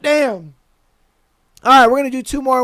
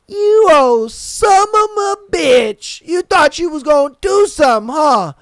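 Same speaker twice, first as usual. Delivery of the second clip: taunting yell; 4 semitones higher, 3 dB louder than usual. Retold or imitated. Yelling after the fleeing driver, high loud gloating taunt. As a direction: rub it in, shouting after him.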